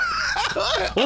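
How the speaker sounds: high-pitched burst of laughter. Real, not acted. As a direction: break into giggles. Laughing at own story.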